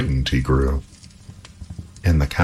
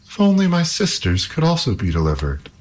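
wishful princess; 10 semitones higher than usual. A narrator's reading. Princess wishes her sisters freed.

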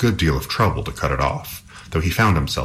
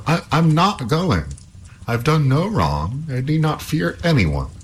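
high, slow, insistent hero's cry; defiant Halvor; 10 semitones higher than usual. A narrator's reading. Halvor insists to princess he fears no one, defiant. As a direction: dig your heels in, pitched well up. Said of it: "cried Halvor".

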